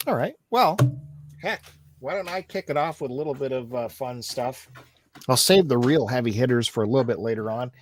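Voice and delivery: normal talking voice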